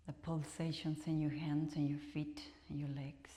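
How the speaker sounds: soft whispered meditation voice; guided relaxation. Acted. Teacher guides students in final rest, whispered. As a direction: ease them into stillness.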